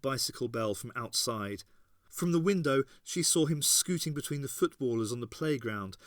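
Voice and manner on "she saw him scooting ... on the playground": ordinary delivery, nothing marked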